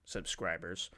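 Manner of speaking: low, soft creepy caller voice; Ghostface voice. Shown as a demonstration. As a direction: rasp it like the Scream killer on the phone. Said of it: "Terrible ghost face impression".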